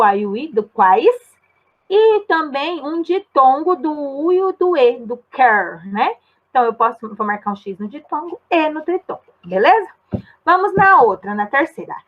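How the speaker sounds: ordinary talk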